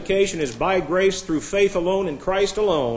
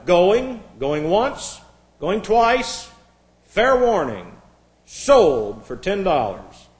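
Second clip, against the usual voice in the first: auctioneer's closing call, punched loud; measured gavel-down calls with gaps between; 3 semitones higher, 4 dB louder, much slower than usual. Acted. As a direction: ring out each step of the sale. Acted auctioneer closing the sale.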